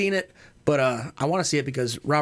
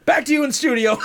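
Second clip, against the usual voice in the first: higher, louder reporter sign-off; mock reporter shout; 8 semitones higher, 5 dB louder, faster than usual. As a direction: toss back to the studio with a yell.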